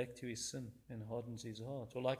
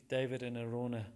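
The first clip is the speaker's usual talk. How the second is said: ordinary talk, nothing marked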